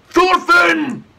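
loud angry bark, pitched high. A narrator's reading. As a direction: bark the name like a furious chief. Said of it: "barked Eric the Ear Masher".

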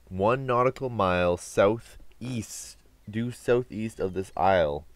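slow, dramatic reading of a clue; reading aloud. Real, not acted. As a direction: read the clue out slowly and grandly. Reading game text aloud.

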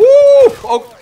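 high, loud sudden gasp; startled yelp. Real, not acted. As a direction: jolt of surprise. Sudden gameplay shock.

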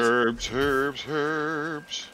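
high-pitched mock advert chant; Pearle commercial imitation. Player imitating a glasses commercial for fun, high mock chant. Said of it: "that was a Pearl reference".

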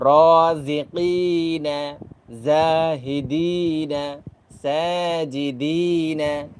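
each word drawn out slowly with long madd; elongated vowels. Shown as a demonstration. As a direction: read each word stretching the vowel one alif. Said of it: "কারণ আমি আবার পড়ি". (মদ টেনে ধীরে পড়া)

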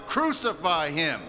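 normal talking voice

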